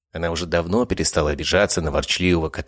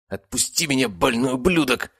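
higher, angry demand; angry captive; 8 semitones higher than usual. A narrator's reading. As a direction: struggle and snarl at him. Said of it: "ворочался Павел Булгаков". (зло, вырываясь)

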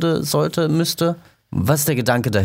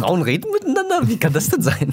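sarcastic, high, amazed tone; mock surprise; 11 semitones higher than usual. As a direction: feign shock, dripping with irony. Sarcastic remark about gossip.

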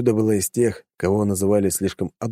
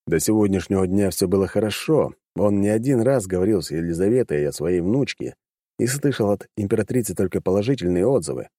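plain reading voice

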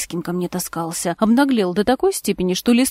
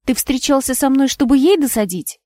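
higher, louder, all in one breath; blurted out; 5 semitones higher, 5 dB louder than usual. A narrator's reading. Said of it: "выпалила на одном дыхании". (выпалила на одном дыхании)

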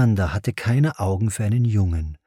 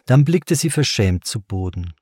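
usual speaking voice

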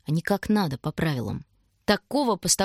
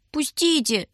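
high pleading cry; крик; 8 semitones higher, slower than usual. A narrator's reading. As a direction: shout to be let go. Шурка вырывается у милиционера. (пронзительный крик)

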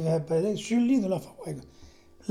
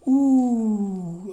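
higher, sustained hoot of reaction; long drawn-out "ooh"; 6 semitones higher, 5 dB louder than usual. Retold or imitated. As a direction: let out a long "ouh" at the remark. Reacting to the retold remark. (« ouh » longuement tenu)

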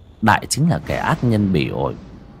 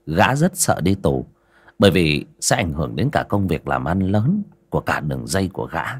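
usual speaking voice

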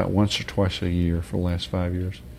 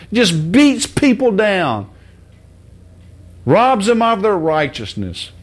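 preaching at full force; 9 semitones higher, 5 dB louder than usual. Real, not acted.

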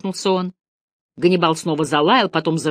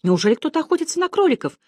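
hushed gasp, then a high, quick question; shocked disbelief, rushing upward; startled exclamation; 8 semitones higher, faster than usual. A narrator's reading. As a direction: exclaim in alarm, pitch leaping up. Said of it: "воскликнула крошка".

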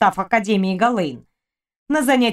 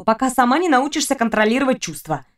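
plain reading voice

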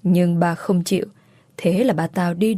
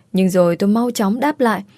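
ordinary delivery, nothing marked

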